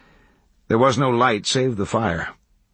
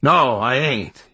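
higher, drawn-out refusal; mildly raised outcry; crying out; 5 semitones higher, 4 dB louder, slower than usual. A narrator's reading. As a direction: cry out slowly as a backwoods hermit. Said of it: "cried the hermit".

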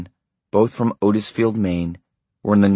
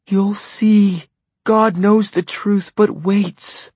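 much higher, slow tearful warning; through tears; 13 semitones higher, 4 dB louder, much slower than usual. A narrator's reading. Wife warning her husband through her tears, tearful. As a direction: weep out the prophecy. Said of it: "she said through her tears".

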